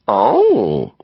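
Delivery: "Oh" stretched into three long sounds; three-part "Oh". A narrator's reading. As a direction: draw out "Oh" so it rises and falls in three parts. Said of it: "making three long sounds out of one".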